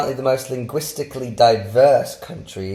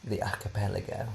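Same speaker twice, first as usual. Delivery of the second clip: posh toff; 10 dB quieter than usual. Pretend. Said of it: "can't say that word without being a toff".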